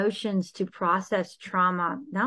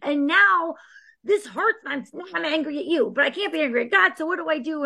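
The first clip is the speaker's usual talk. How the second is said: raised, strained complaint to God; angry at God; 7 semitones higher, 3 dB louder than usual. Acted. Grieving believer confronting God in anger, raised and strained. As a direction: accuse God, hurt and indignant. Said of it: "or anger".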